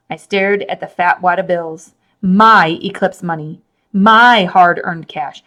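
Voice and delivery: ordinary delivery, nothing marked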